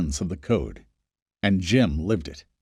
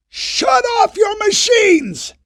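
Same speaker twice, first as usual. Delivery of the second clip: much higher, louder, very slow shout; yelled; 5 dB louder, much slower than usual. Acted. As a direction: bellow it over the pumps at full force. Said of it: "Jacob yelled".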